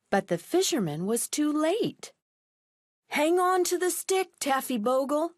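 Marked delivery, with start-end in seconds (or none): 3.1-5.3 higher calling voice; called out. Acted. Fisherman calls to the dog in the water. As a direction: call across the water. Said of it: "he called".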